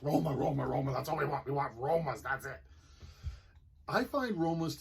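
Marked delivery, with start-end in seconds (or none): 0.0-2.6 a lightly voiced, louder chant of friends pleading; imitating Filipino friends. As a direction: playfully mimic friends insisting on one tomato. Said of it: "and they're like".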